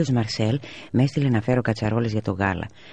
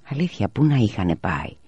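ordinary delivery

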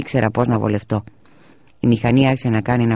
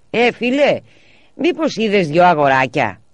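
calling out; 8 semitones higher, 5 dB louder than usual. A narrator's reading. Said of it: "άρχισε να ρωτάει ο κύριος Μαρσέλ".

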